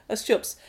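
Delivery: A stjupes with a soft unvoiced suck through the teeth; A stjupes, voiceless and sucked. Shown as a demonstration. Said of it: "how do you say".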